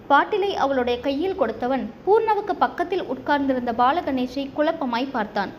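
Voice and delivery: usual speaking voice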